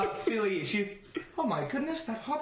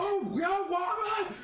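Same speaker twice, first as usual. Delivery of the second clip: surprised; 12 semitones higher, slower than usual. Acted. Guards surprised by news.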